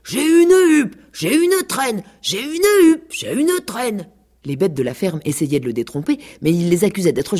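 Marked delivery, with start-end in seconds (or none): 0.0-4.1 much higher, loud gleeful chant; shouting at the top of his lungs. A narrator's reading. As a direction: crow it out across the yard. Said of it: "en criant à tue-tête".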